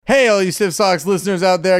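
lightly voiced stadium announcer; baseball announcer. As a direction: announce it like a ballpark PA. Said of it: "I sound like a baseball announcer".

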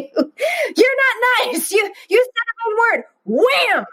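put-on high, childish tattling voice; squeaky toddler tattling; squabbling kid. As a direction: imitate a little kid accusing a sibling. Kids fighting, voiced by speaker.

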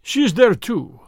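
a touch of grudging resentment; sullen. A narrator's reading. Russian gives up the other girl, sullen. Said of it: "said the Russian sullenly".

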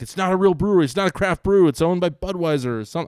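mock-indignant correction; scoffing dismissal. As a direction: brush off the brewery as fake. John's scoffing quote retold.

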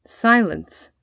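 ordinary delivery, nothing marked

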